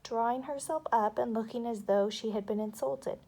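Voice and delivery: plain reading voice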